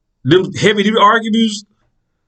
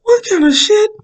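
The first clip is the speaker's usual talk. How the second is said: sudden high startled cry; jolted awake and outraged; startled outburst. Retold or imitated. Woken by noise, startled.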